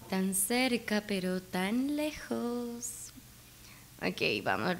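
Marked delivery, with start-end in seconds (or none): 0.1-3.1 soft, slowing, drawn-out sigh of words; resigned lament. Real, not acted. Player so close but so far, resigned lament. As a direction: let it sink quietly, stretching the end. Resigned after near miss.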